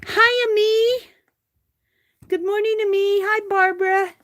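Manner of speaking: sing-song welcome to viewers in live chat; cheery greeting. Real, not acted. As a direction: call out a happy hello to friends.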